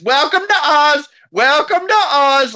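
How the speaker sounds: high, drawn-out mock greeting call; muffled old man announcer. Masked old man greeting the actors over and over in a drawn-out mock announcer voice. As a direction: repeat the old man's drawn-out welcome over and over. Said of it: "old man in a rubber mask is".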